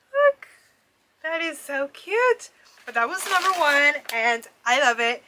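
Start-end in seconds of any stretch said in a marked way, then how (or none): 0.1-2.5 excited squeal. Real, not acted. Showing a light-up ornament.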